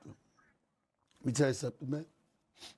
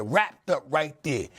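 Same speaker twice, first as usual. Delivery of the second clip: ordinary delivery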